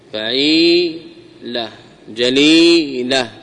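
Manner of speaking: فعيلة، جليلة very slowly, long held ee before la; drawn-out pattern demo. Shown as a demonstration. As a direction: stretch fa-'EEE-la, ja-LEEE-la in فعيلة، جليلة. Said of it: "العين واللام من جنس واحد". (فعيلة، جليلة ببطء مع مد الياء)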